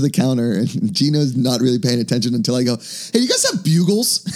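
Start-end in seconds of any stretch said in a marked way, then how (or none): 2.8-4.3 high, drawn-out hopeful question; eager request. Retold or imitated. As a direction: imitate an eager customer asking the clerk. Acting out asking the store clerk.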